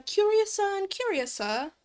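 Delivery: lilting attempt at a British accent. Streamer trying out a voice for the game's heroine, British accent. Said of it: "British Kirigoya?".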